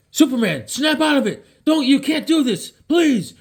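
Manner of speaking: high, strained pleading; desperate plea. Retold or imitated. Reed begging Superman to snap out of it, high and desperate. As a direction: beg him to come back.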